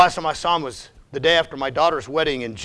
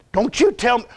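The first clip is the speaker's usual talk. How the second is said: usual speaking voice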